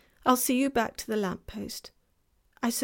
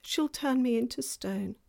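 higher, miserable faun voice; beginning to cry; 6 semitones higher, 7 dB louder than usual. A narrator's reading. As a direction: lament dreadful punishments as the tearful faun. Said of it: "beginning to cry again".

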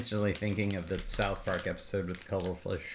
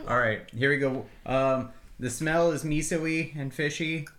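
ordinary delivery

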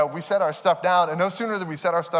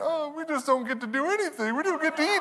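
put-on high-pitched complaining child; cynical, pessimistic kid; 9 semitones higher, 3 dB quieter than usual. Kids complaining once home, high pessimistic whine. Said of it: "cynical and pessimistic".

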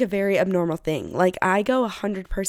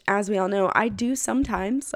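ordinary delivery

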